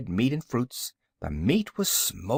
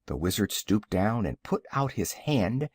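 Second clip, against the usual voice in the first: ordinary delivery, nothing marked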